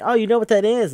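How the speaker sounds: light up at a shared interest; pleasantly surprised. Retold or imitated. Computer guy to the player who knows his hobby, pleasantly surprised. Game character amazed she knows the term.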